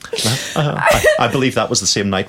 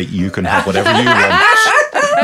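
gooey; 4 dB louder, slower than usual. Real, not acted. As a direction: melt into it, slowly. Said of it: "Missus N's gone all gooey now".

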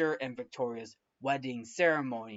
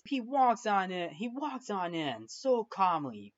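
ordinary delivery

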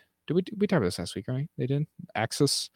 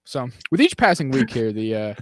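ordinary delivery

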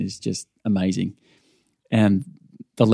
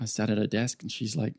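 normal talking voice

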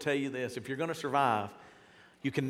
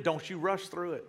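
ordinary delivery

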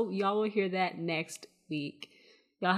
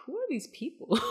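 high-pitched, amused disbelief with a laugh; laughingly incredulous, voice pitched way up; laughing bewilderment; 10 semitones higher than usual. Retold or imitated. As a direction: retell your baffled question through laughter. Retelling own bewildered reaction, laughing.

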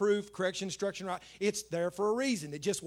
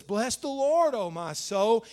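loud, raised proclamation of the verse; emphatic repetition; 6 dB louder, slower than usual. Acted. Preacher repeating the psalm for emphasis, emphatic. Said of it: "he says it again for emphasis".

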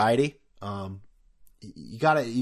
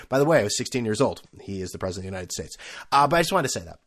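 ordinary delivery

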